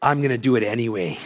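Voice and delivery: a hint of a stubborn little boy muttering; lightly voiced kid grumbling under his breath; defiant five-year-old muttering, mildly imitated; growly mutter. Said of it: "he mutters in a kinda growly voice under his breath".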